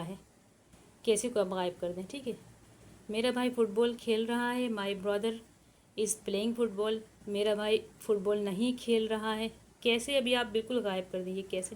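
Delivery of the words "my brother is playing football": very slow, word by word for students to write; slow, careful dictation pace. Shown as a demonstration. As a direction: spell it out slowly for the class to copy. Teacher dictating English sentence.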